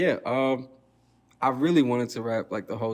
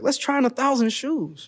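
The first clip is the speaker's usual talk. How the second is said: high squeaky centipede voice; high cartoon voice; 11 semitones higher than usual. Voicing the centipede.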